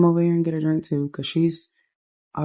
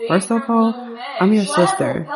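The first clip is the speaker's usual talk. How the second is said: higher-pitched, mildly indignant retort; indignant sister; 7 semitones higher than usual. Acted. Sister answering a complaint about yelling, mildly indignant. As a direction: pull rank with a touch of outrage.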